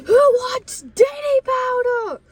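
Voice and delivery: high, drawn-out holler; full-on yelling. Retold or imitated. Kid offering hair powder to the bus, full-on yell. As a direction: call it out to the whole bus. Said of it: "full on yelling it to the bus".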